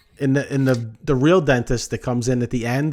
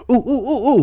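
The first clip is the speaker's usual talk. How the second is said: high, quick run of oohs; hammed-up startled oohing; imitated alarm; 10 semitones higher, 4 dB louder than usual. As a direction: mimic someone catching their own mistake. Dentist alarmed at mask left on.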